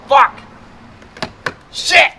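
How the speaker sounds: sharp, high, loud swearing; pained curse. Real, not acted. Fumbling the car door trim, a sharp high loud curse. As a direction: blurt it out as something goes wrong. Mishap while pulling door panel.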